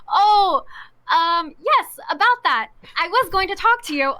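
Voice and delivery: high, loud, put-on awkwardness; retold sheepish stalling. Caught employee answers boss in hallway, sheepish. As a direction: act out being caught out, stalling.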